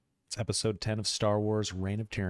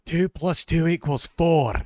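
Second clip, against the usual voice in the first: loud, high, slow mock-Scottish; hammed-up Scottish thinking voice; Scottish accent; 7 semitones higher, 9 dB louder than usual. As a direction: declaim it proudly in a Scottish accent. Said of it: "gonna think with a Scottish accent".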